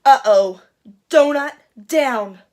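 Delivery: loud, then slow dismay. Acted. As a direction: drop it like an oops. Donut accidentally eaten.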